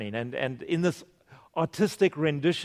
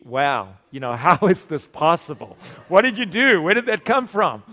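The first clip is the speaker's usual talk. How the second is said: a touch of laughing wonder, louder at the end; amazed disciples; 5 dB louder than usual. Retold or imitated. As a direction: marvel as if seeing bread multiply.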